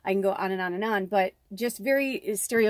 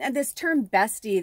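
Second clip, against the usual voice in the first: usual speaking voice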